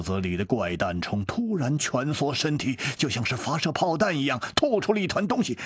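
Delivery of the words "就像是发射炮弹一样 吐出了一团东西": usual speaking voice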